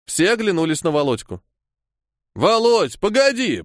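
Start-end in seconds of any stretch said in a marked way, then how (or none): 2.3-3.6 high-pitched call; raised, urgent hail; calling after someone. A narrator's reading. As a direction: call out to stop him leaving. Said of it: "позвал Андрюха".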